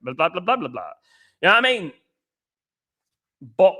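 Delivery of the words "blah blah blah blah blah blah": low, fading mumbled blah-blahs; mock droning chatter. Mocking other channels to his viewers, droning mumble. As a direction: imitate tedious waffle, trailing down low.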